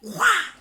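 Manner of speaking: sudden high, loud slap sound; imitated slap noise. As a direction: mimic a slap with a sharp shout. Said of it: "He just went".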